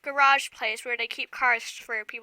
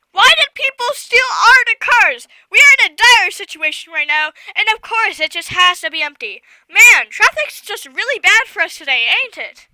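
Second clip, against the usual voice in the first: outraged rant; 6 semitones higher, 9 dB louder than usual. Real, not acted. Outraged rant about rentals.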